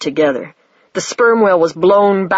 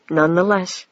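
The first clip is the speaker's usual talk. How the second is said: ordinary delivery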